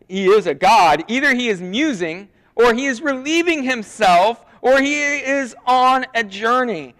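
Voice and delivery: mocking. Elijah taunting the prophets about their god, mocking. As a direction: needle them with exaggerated, loud suggestions. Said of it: "Elijah mocked them".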